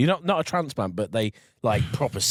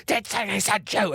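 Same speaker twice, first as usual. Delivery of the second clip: higher put-on voice of the killer; killer's voice; 9 semitones higher than usual. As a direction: snap back in his light voice. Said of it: "And he goes".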